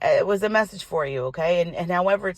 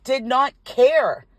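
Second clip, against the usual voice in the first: "did not care" higher, each word drawn out slowly; indignant emphasis; 5 semitones higher, 4 dB louder, much slower than usual. Real, not acted. Woman bitten by the dog about its owner, indignant emphasis. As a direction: stretch "did not care" in slow disbelief.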